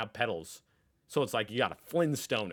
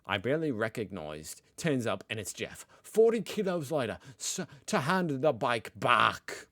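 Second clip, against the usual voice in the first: put-on Australian accent; 4 semitones higher, 6 dB louder than usual. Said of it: "read this in my best Australian accent".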